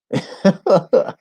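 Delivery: real high chuckle in short bursts; amused chuckle. Real, not acted. Streamer reading a friend's reply, amused high chuckle.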